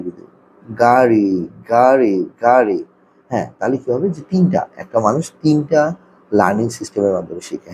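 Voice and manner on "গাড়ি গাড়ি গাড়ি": slow, deliberate repetitions of গাড়ি for a learner; drawn-out word demo. Shown as a demonstration. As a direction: say গাড়ি slowly, stretching each syllable. Demonstrates word for picture-matching teaching. (গাড়ি টেনে টেনে ধীরে বলা)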